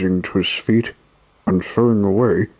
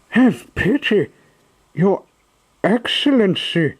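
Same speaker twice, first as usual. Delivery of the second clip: high pleading puppet voice, much higher; supplicating voice; 11 semitones higher than usual. Acted. Pinocchio begging the showman, high pleading. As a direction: beg for mercy in a high voice. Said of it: "in a supplicating voice".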